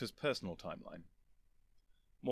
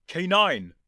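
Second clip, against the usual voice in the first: loud, high urgent call; 7 semitones higher, 12 dB louder than usual. Acted. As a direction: shout across to the robot. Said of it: "the Doctor cried".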